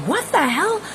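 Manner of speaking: high, startled "What the hell?"; shocked. Retold or imitated. Seeing smoke bellow from the car, shocked. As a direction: blurt it out in sudden alarm. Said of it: "And I'm like".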